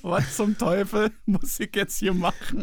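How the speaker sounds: a hint of a bewildered elk; desperate elk voice. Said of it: "Dann guckt er dich so verzweifelt an".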